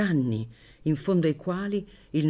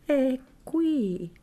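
timid high little voice; frightened; 11 semitones higher than usual. Acted. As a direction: say it scared and small. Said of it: "disse Maddalena impaurita".

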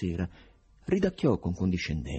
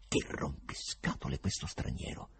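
softer, unvoiced annoyed grumble; whispered angry thought; 5 semitones lower, 8 dB quieter than usual. A narrator's reading. Poet silently cursing the stranger, whispered annoyed thought. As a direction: mutter it to yourself, irritated. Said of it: "pensava arrabbiato".

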